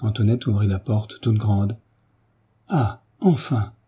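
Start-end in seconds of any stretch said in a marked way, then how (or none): 2.7-3.7 drawn-out, higher cry of relief; joyful cry. A narrator's reading. Marquis greeting the long-awaited priest, joyful. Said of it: "s'écria joyeusement le marquis".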